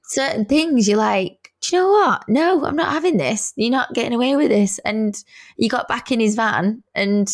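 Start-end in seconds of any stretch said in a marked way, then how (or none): 1.6-4.8 high-pitched, feisty put-down, re-enacted; indignant refusal. Standing up for her driver, indignant retold refusal. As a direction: put your foot down, pitch jumping up.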